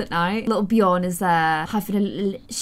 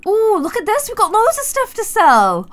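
excited; 11 semitones higher, 5 dB louder than usual. Real, not acted. Delighted at goods.

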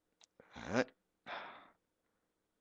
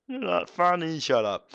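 laughing; 10 semitones higher, 8 dB louder than usual. Real, not acted. Laughing at own joke.

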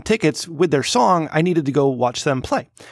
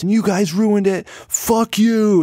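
higher, mock-angry yell at the crowd; angry band member; 7 semitones higher, much slower than usual. Retelling a singer cursing the bottle-throwing crowd, mock angry yell. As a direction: imitate a fed-up singer telling off the crowd. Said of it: "but all you hear is".

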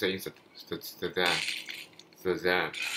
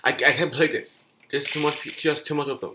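loud, high protest that it's too much; exasperated outcry; 7 semitones higher, 5 dB louder than usual. Real, not acted. Gamer overwhelmed by enemy troops, exasperated outcry. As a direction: cry out that the game is unfair.

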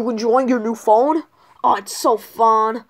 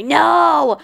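long, higher cry of refusal; protesting yell; 6 semitones higher, 5 dB louder than usual. Acted. Junior refuses to give up his phone, drawn-out protesting yell.

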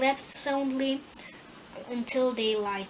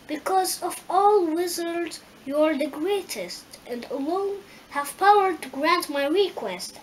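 higher, louder earnest appeal; pleading Lion; 5 semitones higher, 6 dB louder than usual. A narrator's reading. Lion begging the great Wizard for help, pleading. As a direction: make the case in a raised, urgent voice.

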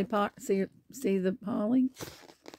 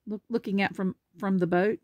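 usual speaking voice

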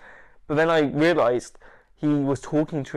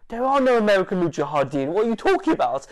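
loud, raised-pitch challenge; higher and forceful, as if contradicting nonsense; acted incredulity; 7 semitones higher, 5 dB louder than usual. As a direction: act out baffled, indignant disbelief. Retold Taliban disbelief.

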